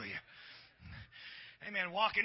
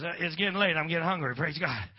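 usual speaking voice

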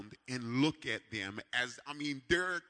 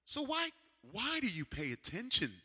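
ordinary talk

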